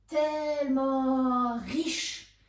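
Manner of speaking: TELLEMENT stretched long and loud; emphatic hyperbole. A narrator's reading. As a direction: draw out "Tellement riche" slowly. (« Tellement » étiré avec emphase)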